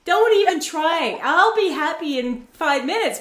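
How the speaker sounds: high-pitched, indignant mock outburst; angry. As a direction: act out being fed up with cheering-up. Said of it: "moments where I was angry".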